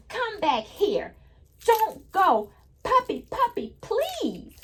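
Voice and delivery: high, sing-song pleading read-aloud; storybook reading voice. Acted. Teacher reading the picture book to kids, high sing-song plea. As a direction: read the line like a child calling her puppy.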